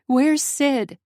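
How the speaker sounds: high, loud, alarmed question; panicked demand. A narrator's reading. Panicked woman demands missing cat.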